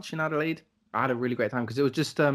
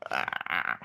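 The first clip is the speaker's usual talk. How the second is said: Gollum groan; 11 semitones lower, 4 dB quieter than usual. Retold or imitated. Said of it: "like fucking Gollum".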